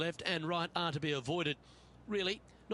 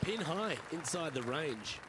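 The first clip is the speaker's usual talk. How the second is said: golf commentator; 3 dB quieter than usual. Acted.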